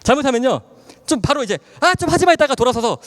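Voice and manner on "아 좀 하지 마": mock snap. Snapping at mother.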